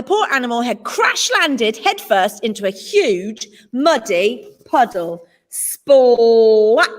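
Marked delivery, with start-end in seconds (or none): none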